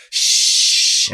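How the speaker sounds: slow airy shh of a body sliding on ice; sliding hiss. Retold or imitated. [name] mimicking his slide on the ice for the phone, drawn-out hiss. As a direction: stretch out a long breathy shh. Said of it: "so you can hear the".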